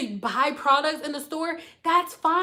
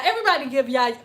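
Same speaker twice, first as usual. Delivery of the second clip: ordinary talk, nothing marked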